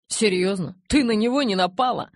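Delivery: loud, high-pitched disbelief; incredulous. A narrator's reading. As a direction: blurt it out, astonished. Acted disbelief in a narrated dialogue.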